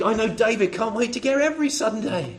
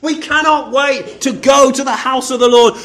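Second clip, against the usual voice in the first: ordinary delivery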